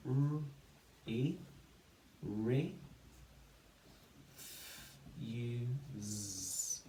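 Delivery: R, E, then RE, then F, U, Z held apart; sounding out. Shown as a demonstration. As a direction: build REFUSE from isolated sounds. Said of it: "build the syllables to build the word".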